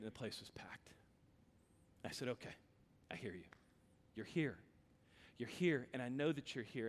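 soft, awed, near-whispered prayer; low, soft and overwhelmed; breathless whisper. Real, not acted. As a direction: hush as if God just walked in.

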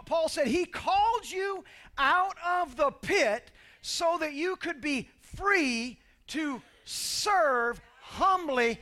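loud, high, rising sermon climax; preaching at full force. Acted. Pastor to congregation, rising to a full-force preached climax.

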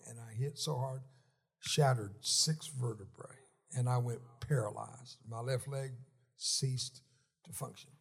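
ordinary talk, nothing marked

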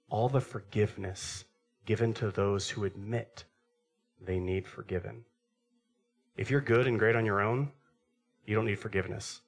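ordinary delivery

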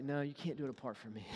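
soft, quiet correction; Jesus gently correcting his follower; gentle correction. Retold or imitated. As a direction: tell him softly but firmly. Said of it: "He's like".

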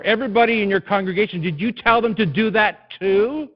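loud, high, driving sermon voice; full-force preaching. Acted. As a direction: preach it, building to the Lord's questions.

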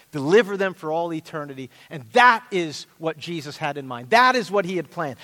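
ordinary talk, nothing marked

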